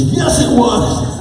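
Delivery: high, loud and slow insistence; stubborn teen girl. Retold or imitated. Teen patient insisting to the doctor, high slow pouty whine. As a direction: drag it out, pouting and stubborn.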